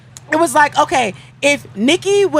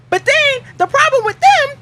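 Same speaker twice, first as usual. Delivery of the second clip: excited; 9 semitones higher than usual. Real, not acted. Excited reveal of tied times.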